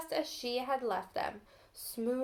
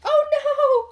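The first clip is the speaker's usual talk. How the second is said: much higher, much louder cry of dismay; alarmed cry; 14 semitones higher, 14 dB louder than usual. A narrator's reading. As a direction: cry out as if the eggs just broke. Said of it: "cried Dora".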